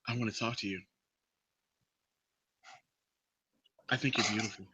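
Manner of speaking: very low, hushed creep voice; creepy come-on. Acted. Creepy guy from the bar approaching her, creepy. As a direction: murmur it low like a creepy admirer. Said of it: "he does that creepy voice that I just did".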